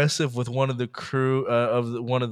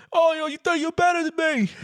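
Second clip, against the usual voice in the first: high, belligerent mock British accent; thick British accent. Drunk passenger squaring up to flight attendant, thick mock British accent. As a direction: challenge them in an exaggerated British accent. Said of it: "like, a thick ass accent".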